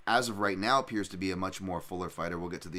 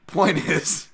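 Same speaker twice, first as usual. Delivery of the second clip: laughing; 9 semitones higher, 11 dB louder than usual. Real, not acted. Laughing after the impression.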